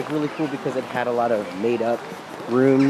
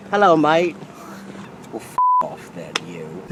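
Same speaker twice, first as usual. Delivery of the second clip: mock English accent. Joking for the camera in a mock English accent. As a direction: bark a cheeky fake-English brush-off. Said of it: "questionable English accent".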